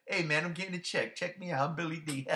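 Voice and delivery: lightly voiced carefree actor cashing a check; teasing the actor as too happy to be there; mock Billy Dee. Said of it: "he was just way too happy being there".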